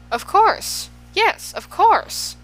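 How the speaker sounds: high, loud delighted shout, drawn out slow on the second. A narrator's reading. Canby shouts to Milo on learning his name, high and loud. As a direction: cry it out as if the answer just dawned. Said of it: "the man shouted".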